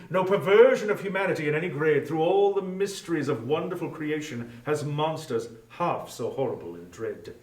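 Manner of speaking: usual speaking voice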